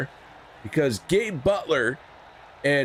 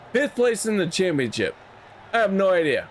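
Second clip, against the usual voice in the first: ordinary talk, nothing marked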